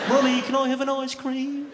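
put-on very high, loud child calling out; whining kid's accent. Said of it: "It's that accent again".

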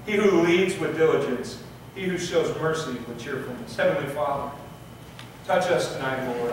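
normal talking voice

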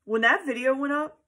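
ordinary talk, nothing marked

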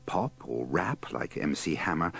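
ordinary talk, nothing marked

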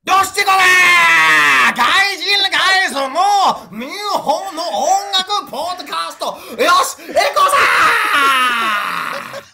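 loud, slow Japanese TV host shout. Shown as a demonstration. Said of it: "Japanese T V voice".

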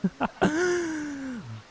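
a high, delighted burst of laughter; relieved laugh. Acted. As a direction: laugh out in disbelieving joy. Overjoyed at being offered a room.

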